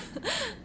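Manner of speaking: high light giggle after a tease; playful giggle. Acted. Courtesan giggling after teasing the hero, playful. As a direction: giggle at your own joke.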